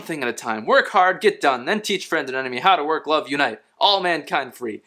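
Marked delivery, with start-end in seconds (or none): none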